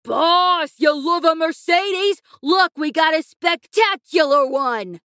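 excited thug shouting; screamed. A narrator's reading. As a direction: holler proudly across the garage.

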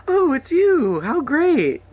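very high, loud, gushing delight; delighted squeal. Retold or imitated. Mocking the ad couple, squealing with delight. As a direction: gush like you're thrilled to find a burglar.

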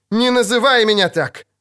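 high, indignant outburst; angry rebuke. A narrator's reading. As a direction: snap at him, offended. Sorceress angrily rebukes witcher. (сердито и возмущённо одёрнуть)

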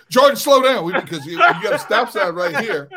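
high, loud yell at the driver; retold scream. Dad to son speeding downhill toward stop sign, screaming. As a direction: shout it, urgent, as the warning gets ignored. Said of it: "so I had to scream at him".

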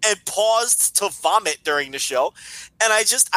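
high, exasperated protest; indignant rant. Real, not acted. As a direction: rant in disbelief, offended. Outraged at being doubted.

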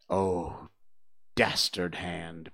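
slow, low, grave reproach; low, solemn denunciation; grave condemnation. A narrator's reading. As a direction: draw it out with dark disdain. Peter condemns the one who shot.

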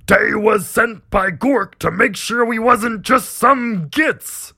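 high, loud, drawn-out orc rant; frenzied orc. Acted. Orc captain convinced of divine intervention, frenzied shout. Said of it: "Whipped into a frenzy".

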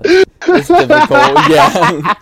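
words breaking into a high laugh; giggling while calling the game difficult. Real, not acted. Laughing at the tough games.

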